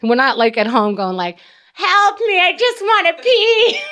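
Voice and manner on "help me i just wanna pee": high, whining help me; playful imitation of a pleading patient; high mock whine.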